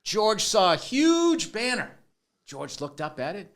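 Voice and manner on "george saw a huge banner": slow, higher and louder read-aloud; hammed-up bedtime narration; storybook reading.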